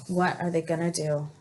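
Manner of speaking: plain reading voice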